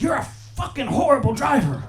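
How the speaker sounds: mock road rage. Yelling at the driver through the window, mock road rage. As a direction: tell off the bad driver.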